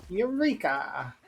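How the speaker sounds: slow hushed mad scientist Eureka; drawn-out triumphant mad scientist. Pretend. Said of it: "I guess maybe I am a mad scientist".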